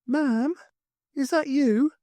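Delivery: put-on timid child asking from the dark; lightly voiced boy, quiet and unsure; asking quietly. A narrator's reading. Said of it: "James asked quietly".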